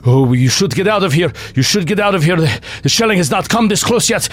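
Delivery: father warning the group to flee; urgent father. Acted. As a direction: rush them out of the building. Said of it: "is like".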